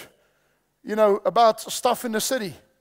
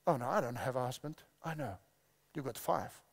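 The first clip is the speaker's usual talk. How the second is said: very soft, low quoted dialogue; hushed acted exchange; 8 semitones lower, 12 dB quieter than usual. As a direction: play both sides quietly, almost under the breath. Preacher acts out woman and Jesus.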